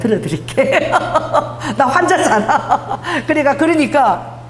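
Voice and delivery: chuckling through the words; laughing. Real, not acted. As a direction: laugh at the irony of it. Laughing at her own offer.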